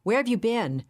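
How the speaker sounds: a mild, narrated snap of a question; sharp demand. A narrator's reading. Roger scolding the late Nicole, mildly sharp. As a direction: fire it at her, a touch impatient. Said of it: "he immediately shot at her".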